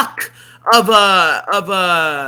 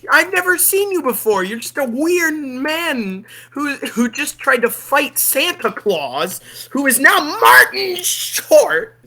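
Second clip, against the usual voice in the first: put-on higher, louder indignant girl; higher, louder mock little girl refusing; imagined niece; 7 semitones higher, 3 dB louder than usual. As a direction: talk back like a scared kid, higher. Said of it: "I would be like".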